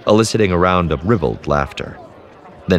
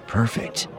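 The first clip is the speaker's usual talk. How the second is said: inner thought; 6 semitones higher, 6 dB quieter than usual. A narrator's reading. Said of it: "Theron thought".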